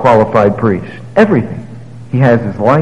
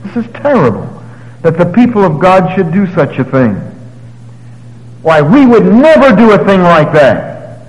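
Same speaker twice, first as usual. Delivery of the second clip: mock indignation; 6 semitones higher than usual. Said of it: "You say".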